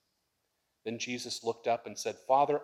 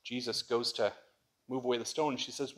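ordinary talk, nothing marked

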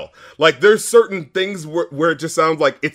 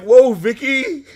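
mock lyric; 9 semitones higher, 5 dB louder, slower than usual.

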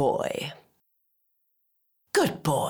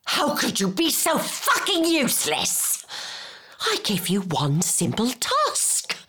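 high-pitched outburst on the insult, then settling; exasperated scolding; 4 semitones higher than usual. Acted. Berating someone useless at one simple task, high and exasperated. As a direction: start high and outraged, then come down.

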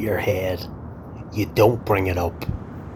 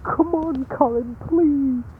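pleading; slower than usual. Acted. Simon begging.

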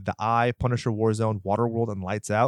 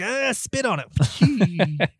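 laughing mock quote; 9 semitones higher, much slower than usual. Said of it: "He's like".

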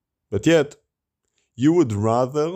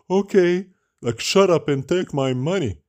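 mock eager buyer; 8 semitones higher than usual.

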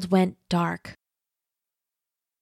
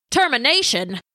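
squawking; 5 semitones higher than usual. A narrator's reading. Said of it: "squawked Specs".

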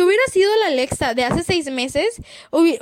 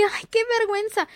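mock crying from shame; 6 semitones higher than usual. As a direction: act like you're about to cry from embarrassment. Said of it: "llorar por la vergüenza".

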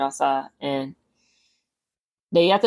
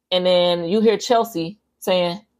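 ordinary delivery

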